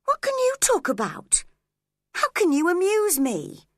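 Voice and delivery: high, scornful cross jabs; cross, mocking. Catherine mocking Heathcliff crossly, high and scornful. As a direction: sneer at him crossly, pitch flying up. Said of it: "she asked crossly".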